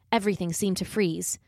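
usual speaking voice

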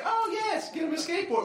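high, over-agreeable humoring voice; polite fake agreement. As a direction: humor a stranger in a high pitch. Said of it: "I did the polite thing".

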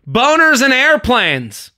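high, slow mock yell of an older woman; heckler's yell. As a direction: stretch it out high like a drunk heckler. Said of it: "she just yells out".

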